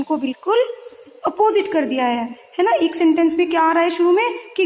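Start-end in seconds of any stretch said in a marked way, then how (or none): none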